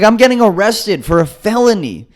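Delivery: high, exasperated complaint; distressed protest. Retold or imitated. Upset protest to arresting cop.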